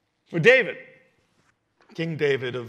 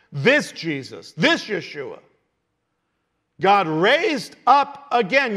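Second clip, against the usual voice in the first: raised, emphatic preacher's voice with stretched key words; forceful preaching; 4 semitones higher, 6 dB louder than usual. Acted. Preacher as Peter telling the crowd about this Jesus, forceful preaching. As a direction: press each point on the crowd.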